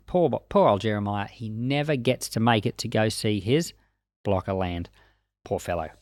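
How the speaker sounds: ordinary talk, nothing marked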